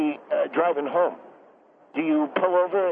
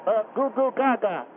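babbling like an infant; baby talk; 4 semitones higher than usual. Acted. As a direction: coo like a baby. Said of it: "I'm allowed to baby talk".

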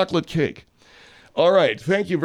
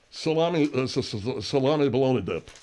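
mouth full; 3 semitones lower, 4 dB quieter than usual. Acted. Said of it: "You got a mouthful of bologna".

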